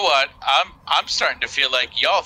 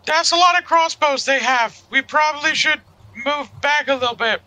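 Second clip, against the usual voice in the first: high, light in-character voice; 13 semitones higher than usual. Acted. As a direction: pitch up for the character. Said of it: "look at Sarai and just like".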